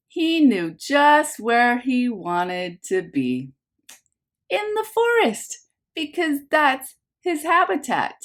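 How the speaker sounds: lively picture-book narration for a class; reading to children. A narrator's reading. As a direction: read it like story time for little ones. Teacher reads picture book aloud.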